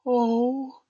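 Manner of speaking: high, loud, heartfelt oh; moved exclamation. A narrator's reading. Wendy hears none were tucked in, moved exclamation. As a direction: cry out, melting at the idea.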